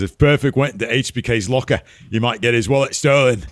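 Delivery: mock British Bulldog accent, lifted up; bad British Bulldog accent. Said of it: "That was not a good Bulldog accent".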